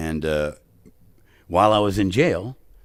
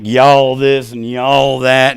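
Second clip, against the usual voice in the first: put-on Texan accent; 4 semitones higher, 8 dB louder, much slower than usual. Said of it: "I'm like".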